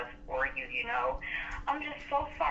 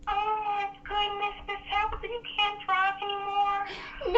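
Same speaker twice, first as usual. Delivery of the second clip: slow, higher, mock-weepy sympathy; mock crying; 7 semitones higher, slower than usual. Agent to caller, pretending to tear up in sympathy. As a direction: whimper along with her in a hammed-up way. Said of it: "I'm gonna start crying too".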